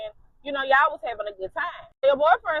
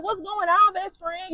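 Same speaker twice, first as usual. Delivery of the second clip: voiced friend, higher and sweet; a bright, higher best-friend hello; friend's greeting; 7 semitones higher than usual. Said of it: "She like".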